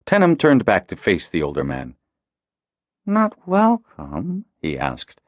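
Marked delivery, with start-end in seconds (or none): 3.0-4.4 higher, very slow hurt question; crestfallen. A narrator's reading. Tenem turned out by Cedar, mock crestfallen and slow. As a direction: act wounded by the rejection. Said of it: "looking crestfallen".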